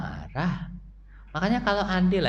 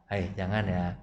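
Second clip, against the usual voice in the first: gentle reproach; 9 semitones lower, 9 dB quieter than usual. Shown as a demonstration.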